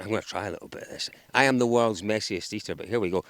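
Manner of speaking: ordinary talk, nothing marked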